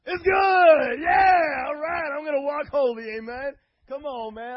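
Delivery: high, loud joyful shouting; ecstatic shout. Real, not acted. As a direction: shout it out in holy joy.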